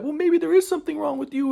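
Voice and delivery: very high mocking falsetto; imitating girlfriend. Girlfriend blaming the victim, in high mocking voice. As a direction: mimic an accusing girlfriend in falsetto. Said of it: "She was like".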